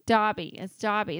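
Dobby pronounced DAH-bee; short-o Dobby. Shown as a demonstration. Insisting on the movie version, Dobby said as DAH-bee. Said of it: "That's what they say in the movies".

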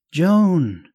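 drawn-out high, loud call across distance; yelling. A narrator's reading. Betty in the water yells to her friend Joan, yelling. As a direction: yell it out long and high to carry. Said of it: "she yelled".

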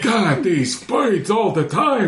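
high, slow, laughing complaint; a fed-up guard moaning about birds; exasperated guard. Acted. Voicing a guard.

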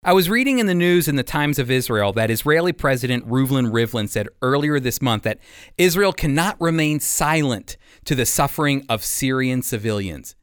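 usual speaking voice

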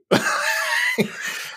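a giggle that jumps way up; high squeaky laugh. Real, not acted. Telling the cohost about her squeak, she breaks into laughter.

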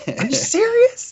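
high, slow disbelieving question; stunned rising disbelief; incredulous. Real, not acted. Shocked at friend's ignorance.

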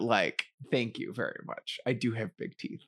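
ordinary delivery

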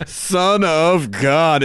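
drawn out slowly; solemn, slow. Pretend. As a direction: say it reverently and slowly.

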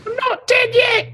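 very high, slow squeaky shout; comic dying man. Retold or imitated. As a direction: yell 'not dead' in a falsetto. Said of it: "those scenes in Monty Python's".

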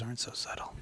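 hushed aside; whispering. Real, not acted. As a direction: whisper it, amused.

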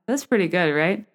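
normal talking voice

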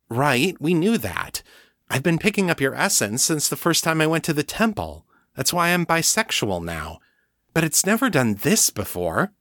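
lightly voiced young woman, uneasy; Kate, anxious. A narrator's reading. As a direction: play Kate insisting, a little rushed. Kate's voice in narration.

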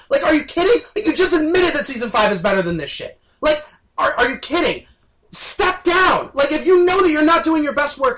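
loud, high, shouting exasperation; real angry shouting, swearing; furious rant. Real, not acted. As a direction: really let him have it.

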